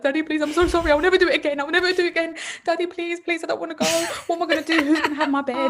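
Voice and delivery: high-pitched imitation of a begging little girl; pleading child. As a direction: plead in a panicked child's voice, words tumbling out. Daughter begging father, voiced by mother.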